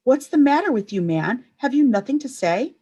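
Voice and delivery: high, loud exasperation; shouting. A narrator's reading. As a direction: shout at the silent prisoner. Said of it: "Pilate was shouting".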